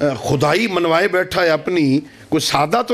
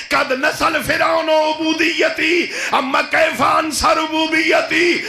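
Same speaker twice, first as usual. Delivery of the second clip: full-force preaching; 9 semitones higher than usual. Acted. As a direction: preach it out with full force, voicing God's command. Climax of sermon, shouted.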